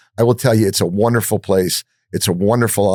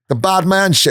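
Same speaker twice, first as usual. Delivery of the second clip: mock yell; 9 semitones higher than usual. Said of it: "I start yelling at him".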